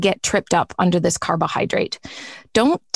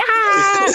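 short hammed-up "Ah!"; mock scream; 11 semitones higher than usual. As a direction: scream like a panicked pancreas. Pancreas screaming.

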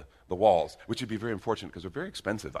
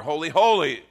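mock seraph repeating the word much louder and higher; 7 semitones higher, 9 dB louder than usual. Preacher playing seraphim, saying it louder. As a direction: shout it as if they weren't heard. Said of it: "I'll say it louder".

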